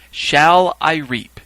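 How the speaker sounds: loud, very slow ceremonial call. Retold or imitated. Reaper asking a third time, calling out. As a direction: draw out each word for the crowd.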